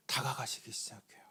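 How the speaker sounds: whisper. Acted. Pastor telling how Jesus drew near the widow, whispered. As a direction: drop to a breathy whisper. Hushed climax of the sermon.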